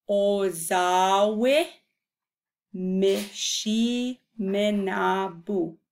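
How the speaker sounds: "Mishiminabu" stretched very slowly, syllable by syllable; said carefully. Shown as a demonstration. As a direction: take each syllable of "Ozawe Mishiminabu" slowly. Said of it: "say it carefully".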